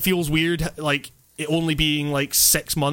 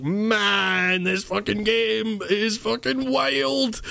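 slow, higher, awed voice of a younger self; amazed younger self; 5 semitones higher, much slower than usual. Retold or imitated. Remembering a first play of Driver, slow amazed awe. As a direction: draw it out like a kid wowed by a game. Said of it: "I was like".

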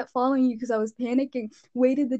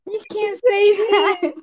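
shocked protest; 8 semitones higher, 6 dB louder, slower than usual. Retold or imitated. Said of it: "I'm like".

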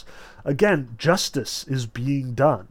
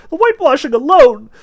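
very high, loud panicked babble; terrified babble; 12 semitones higher, 12 dB louder than usual. A narrator's reading. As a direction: blurt it out in terror. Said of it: "terrified he began pouring out".

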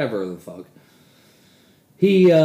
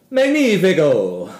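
hammed-up Italian accent, higher and louder, slow; mock Italian accent; 6 semitones higher, 8 dB louder, slower than usual. Praising water hash in a hammed-up Italian accent. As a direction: exclaim magnifico like a joke Italian chef. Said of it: "Sorry for the poor Italian fucking slanderous accent there".

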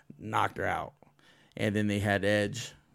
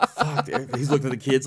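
panicked curse; 4 semitones higher, 7 dB louder than usual. Retold or imitated. Acted Edge panicking, laughing.

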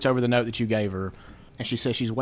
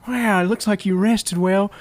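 high, loud, giggling character voice; a character voice breaking into laughs; character voice, laughing; 9 semitones higher, 5 dB louder than usual. Acted. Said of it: "I'm not even gonna do the voice".

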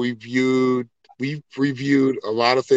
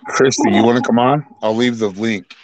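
usual speaking voice

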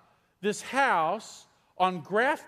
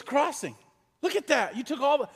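higher, eager Crossing, look at that; excited discovery; 6 semitones higher than usual. Retold or imitated. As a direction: point it out with rising delight. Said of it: "he goes".

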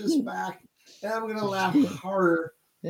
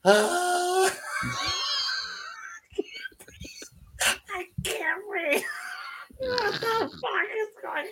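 breathless laughing; 12 semitones higher, 5 dB quieter than usual. Real, not acted. Fit of laughter, can't breathe.

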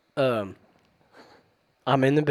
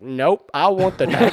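put-on slow, louder stubborn kid whine; whiny spoiled pouty drawl; 6 semitones higher, 7 dB louder than usual. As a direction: pout it like a spoiled child. Child refusing stubbornly.

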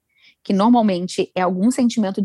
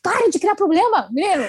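scolding the ego; 8 semitones higher than usual. Retold or imitated. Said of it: "Eu falo".

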